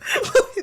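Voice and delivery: high squealing laugh that breaks; cracking up helplessly; burst of laughter. Real, not acted. Laughing hard at the co-host's joke, high squeal.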